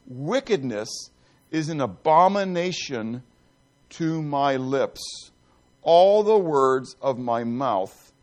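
scripture reading. Acted. Reads Proverbs eight aloud.

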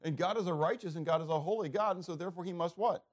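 ordinary delivery

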